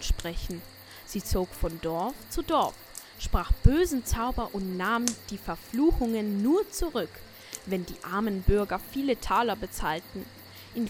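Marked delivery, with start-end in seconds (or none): none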